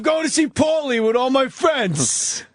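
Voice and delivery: put-on high, slow yelling stoner kid; stoner yelling to mom. A forty-year-old stoner shouting to his mom, high slow yell. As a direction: holler it to Ma upstairs.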